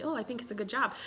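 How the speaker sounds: normal talking voice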